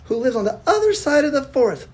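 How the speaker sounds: put-on high sweet child voice; little girl voice. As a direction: explain brightly like a little girl.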